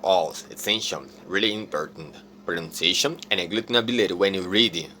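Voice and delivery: ordinary talk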